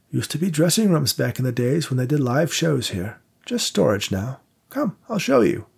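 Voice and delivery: put-on an old vaudeville house keeper showing guests around; old theatre manager. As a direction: play the elderly manager, courteous and unhurried. Victor, the elderly theatre manager.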